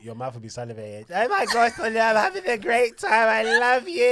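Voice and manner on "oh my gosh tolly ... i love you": put-on high, loud, giddy drunk voice; mock drunk gushing. Host mimics her drunk friend calling, high and giddy. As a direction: gush like a tipsy friend on the phone.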